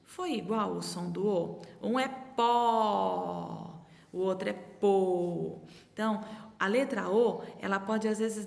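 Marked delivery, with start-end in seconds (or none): 1.8-3.8 "pó" said slowly with an open vowel, as in "pó" with the mouth wide; open O sound. Shown as a demonstration. Teacher showing children the open O in "pó". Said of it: "ela pode ter dois sonzinhos diferentes". 4.1-5.6 "pô" said with a closed, rounded O, set against "pó"; closed O sound. Shown as a demonstration. Teacher contrasting the closed O in "pô" for the class. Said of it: "ela pode ter dois sonzinhos diferentes".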